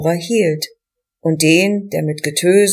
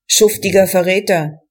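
plain reading voice

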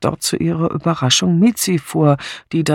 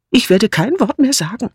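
high-pitched, wounded declaration; offended; 8 semitones higher than usual. A narrator's reading. Mother silenced by her son Edmund, offended. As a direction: announce it with hurt pride. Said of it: "erklärte Misses Swettenham gekränkt".

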